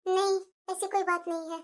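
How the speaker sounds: soft, low, withdrawn voice; shy denial. Acted. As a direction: brush off the question quietly. Bride asked why so quiet. (संकोच से धीमे स्वर में)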